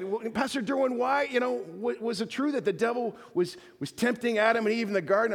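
put-on high, earnest child's question; little girl. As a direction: ask it like a curious toddler. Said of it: "little three or four-year-old girl".

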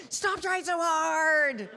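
very loud, high, drawn-out mock shout; comic full-volume high yell; God yelling. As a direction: holler it slowly, comically exasperated parent style. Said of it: "God's like".

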